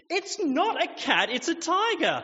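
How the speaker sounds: put-on high, loud, insistent child voice; insistent little girl. Girl contradicting her parents, high and loud in protest. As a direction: dig in like a stubborn toddler.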